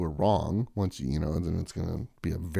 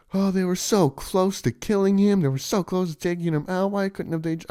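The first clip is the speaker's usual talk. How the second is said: high, put-on whiny voice of a protester; mocking quote; 11 semitones higher, 3 dB louder than usual. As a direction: sneer their words back in a higher voice. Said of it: "People are saying".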